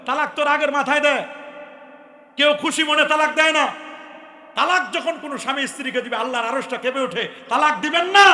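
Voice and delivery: very loud, high preacher's warning; fiery preaching. Acted. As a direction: thunder the warning at the crowd. Preaching waz at full force.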